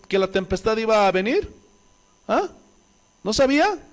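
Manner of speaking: ordinary talk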